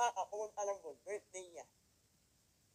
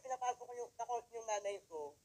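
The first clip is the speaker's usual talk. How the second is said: usual speaking voice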